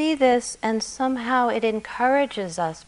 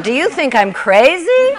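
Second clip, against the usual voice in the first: higher, louder incredulous yell; distraught yell; 6 semitones higher, 8 dB louder than usual. A narrator's reading. Distraught man yells God's words back, incredulous. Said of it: "yelled the distraught man".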